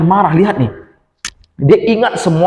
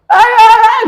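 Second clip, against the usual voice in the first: very high, loud shouts; yelling; 7 dB louder, slower than usual. Acted. Little brother yelling in his sister's face, loud and high. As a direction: yell right in her face. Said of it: "teriak di depan muka".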